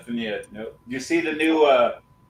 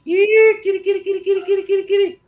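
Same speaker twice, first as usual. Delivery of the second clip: very high sing-song kitty call; 4 dB louder, much faster than usual. Real, not acted. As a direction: chirp kitty over and over, high up. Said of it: "you'd fit right in at the animal shelter".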